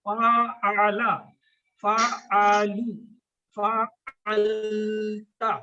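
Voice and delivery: फ़अला, फ़अलू, फ़अलता stretched very slowly, syllable by syllable; slow careful pronunciation. Shown as a demonstration. Teacher showing students exact pronunciation, very slow. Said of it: "हमने तलफ़्फ़ुज़ क्या करना है".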